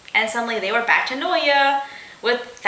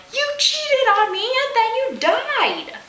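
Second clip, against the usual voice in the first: much higher, accusing cry; yelling; 13 semitones higher than usual. A narrator's reading. Mikasa yelling at her returned lover, high and accusing. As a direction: hurl the accusation at him. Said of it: "And Mikasa yelled".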